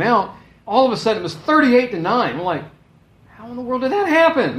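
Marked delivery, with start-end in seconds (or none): 3.4-4.6 loud, high incredulous outburst; acted bafflement. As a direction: re-enact stunned disbelief at the score. Acted baffled reaction to the score.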